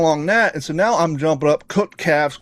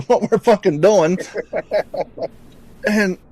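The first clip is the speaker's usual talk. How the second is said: ordinary talk, nothing marked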